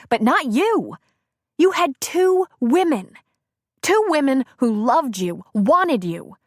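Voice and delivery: wife mocking her cheating husband, bitterly; bitter outburst. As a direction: throw his two women back at him. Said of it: "She laughed at him".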